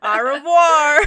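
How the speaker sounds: loud, laughing American-accented French; cheerfully overdone American pronunciation; hammed-up American accent. Shown as a demonstration. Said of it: "as American as you can".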